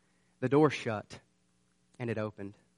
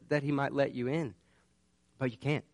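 ordinary talk

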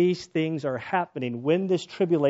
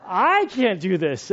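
loud, slow, raised-pitch demand; mock desperate plea; 6 semitones higher, 4 dB louder, much slower than usual. Wife in labor needing her husband, mock desperate cry. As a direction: imitate a wife pleading through labor. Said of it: "And she's like".

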